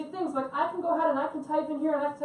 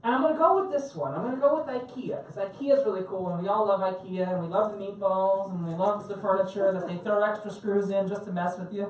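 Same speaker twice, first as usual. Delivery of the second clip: ordinary delivery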